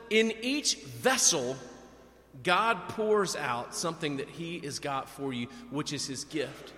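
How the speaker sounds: very slow, deliberate sermon line; slow emphasis. Real, not acted. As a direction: lay out each word slowly for the image. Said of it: "slow emphatic sermon image".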